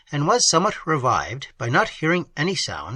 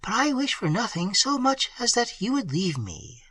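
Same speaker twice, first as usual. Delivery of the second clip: higher, softer polite lady; higher softer woman's voice; 8 semitones higher, 4 dB quieter than usual. A narrator's reading. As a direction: answer high and softly. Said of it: "she replied".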